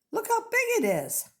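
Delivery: high amazed squeal; mother bird gasping at the size. Acted. Missus Bird amazed at egg.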